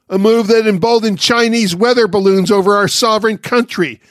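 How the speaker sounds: ordinary talk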